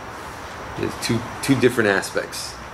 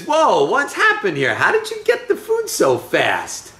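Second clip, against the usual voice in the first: high, animated disbelief; astonished questioning; 9 semitones higher than usual. Acted. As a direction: act out a father taken aback. Acts out Isaac's surprise at speed.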